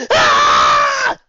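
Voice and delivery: startled loud shriek; loud scream from Fluttershy. Acted. Fluttershy caught in the act, startled scream. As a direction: yelp in shock. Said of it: "Fluttershy screamed".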